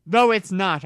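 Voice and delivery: put-on girl shouting at her comforter; angry shout. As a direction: snap it back, furious. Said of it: "I shouted angrily".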